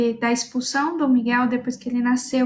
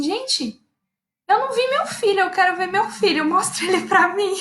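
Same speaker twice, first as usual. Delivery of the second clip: eager demand; 6 semitones higher than usual. Retold or imitated. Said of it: "falei assim".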